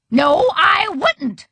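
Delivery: high, slow, indignant refusal; emphatic refusal. A narrator's reading. Grandma refusing to talk to Edward, emphatic and annoyed. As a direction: stretch each word out, annoyed.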